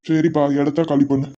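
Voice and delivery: ordinary talk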